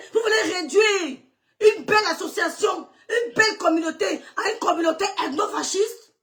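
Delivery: high, indignant yelling at her critics; outraged shouting. Real, not acted. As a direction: shout it out, hurt and furious. Outraged rant at accusers. (cri indigné et furieux)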